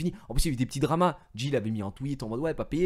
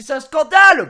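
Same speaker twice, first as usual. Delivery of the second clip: much higher and louder, indignant yelling; outraged shout; 17 dB louder than usual. Retold or imitated. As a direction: shout the injustice at everyone. Retold outrage. (cri indigné, beaucoup plus fort et aigu)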